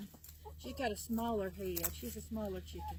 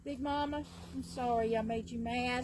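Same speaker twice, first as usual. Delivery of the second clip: usual speaking voice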